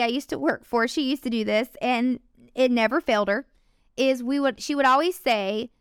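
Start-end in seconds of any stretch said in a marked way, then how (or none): none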